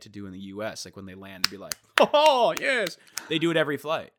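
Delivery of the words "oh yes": high, loud, amazed cheer; mock celebration. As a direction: imitate thrilled first-time flyers on landing. Imitates first-ever flyers celebrating a landing.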